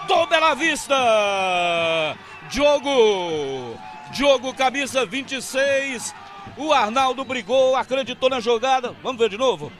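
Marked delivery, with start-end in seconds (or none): 0.0-6.1 explosive goal commentary. Real, not acted. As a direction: let the goal call explode. Narrador grita gol. (narração de gol explosiva)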